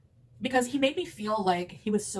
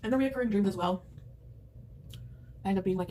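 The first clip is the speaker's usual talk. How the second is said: ordinary talk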